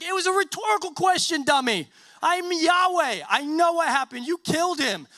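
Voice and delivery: high, loud, indignant rebuke; hammed-up scolding, voice pushed well up; acted exasperated anger. Yahweh confronting Cain over his brother, hammed-up exasperated anger.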